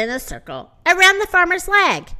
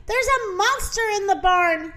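higher-pitched alarmed rooster's cry; mild shout; 7 semitones higher than usual. Acted. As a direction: raise the pitch in alarm, without full volume. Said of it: "shouted the rooster".

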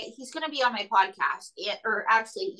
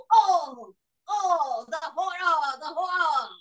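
mock dramatic lament; 4 semitones higher, slower than usual.

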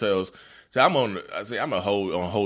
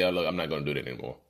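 lower, softer and fast; calm boundary; 5 semitones lower, 4 dB quieter, faster than usual. Pretend. Setting a boundary with the narcissist, lower and quick. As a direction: say it quickly and lightly, no apology.

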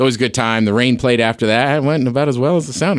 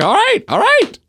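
very high squeak of giving in; squeaky acquiescence. Retold or imitated. A kid backing down after dad's warning, very high squeak. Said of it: "I was like".

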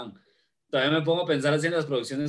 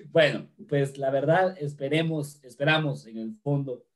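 normal talking voice

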